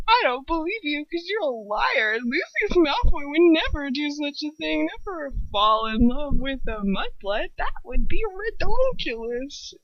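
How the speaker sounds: mock Voldemort. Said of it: "He's just like".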